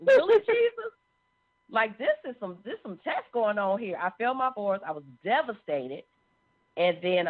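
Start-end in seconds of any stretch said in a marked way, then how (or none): 0.1-0.9 high, loud, drawn-out wail of disbelief; exasperated outcry. Retold or imitated. Failed nursing boards, crying out to Jesus in high loud disbelief. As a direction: cry out to Jesus in stunned frustration, stretching it out. Reacting to failing her boards.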